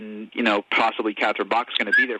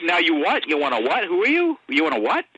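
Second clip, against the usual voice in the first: rising, much higher mock disbelief; incredulous manager; 6 semitones higher than usual. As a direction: imitate a suspicious manager who can't believe the request. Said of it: "He was like".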